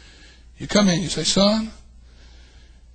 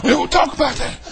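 ordinary delivery

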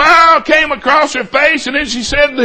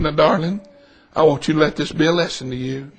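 low, soft, fatherly earnestness; tender counsel; 11 semitones lower, 8 dB quieter, faster than usual. Retold or imitated. Customer counsels waitress holding her hand, tenderly earnest. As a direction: advise her gently in a lowered voice.

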